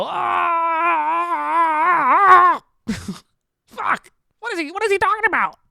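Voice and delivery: very high strained squeal; hammed-up high-pitched exasperated cry; mock anguished wail. Mock reaction to hearing his words.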